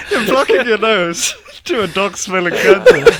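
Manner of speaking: high, talking through laughter; laughing. Real, not acted. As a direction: laugh while making the point. Laughing at the contrast.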